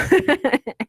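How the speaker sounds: quick amused laugh; a bright real laugh; short laugh. Real, not acted. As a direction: laugh at yourself. Laughs at cats and pizza.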